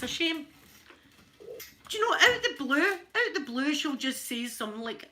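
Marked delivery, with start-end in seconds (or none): none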